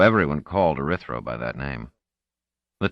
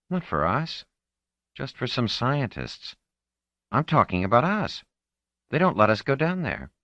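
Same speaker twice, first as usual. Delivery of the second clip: put-on higher girl's voice, sulking and bitter; teenage girl, sulky; 8 semitones higher than usual. Marlena complaining to Orinel, higher sulky girl voice. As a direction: play her as a frustrated fifteen-year-old.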